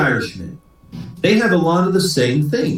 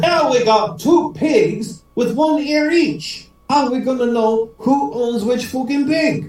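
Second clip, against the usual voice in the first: high, drawn-out Irish exasperation; storming angry; 10 semitones higher, slower than usual. Acted. Swearing Paddy demands to know whose pig, mock angry. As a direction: wail the complaint in a hammed-up brogue. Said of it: "Paddy stormed into the house".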